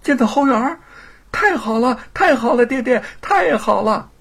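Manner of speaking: put-on high, loud child cheering; delighted shout. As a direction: cheer with surprised joy as a boy. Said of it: "惊喜地喊起来". (孩子惊喜地高声欢呼)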